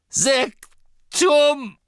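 high, loud curse; shouted curse. Acted. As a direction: hurl it in rage. Harry casts a curse in rage. (wütend geschrien)

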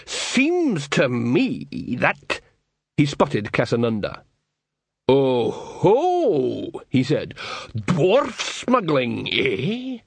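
slow, knowing troll voice; suspicious troll. Acted. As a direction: draw out the suspicion.

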